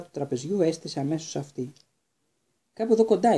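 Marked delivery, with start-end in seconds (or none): 2.7-3.4 higher and louder, bursting with news; excited. A narrator's reading. Seamus spills the news to Harry, excited. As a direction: blurt out the big news eagerly. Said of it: "είπε ενθουσιασμένος ο Σίμους".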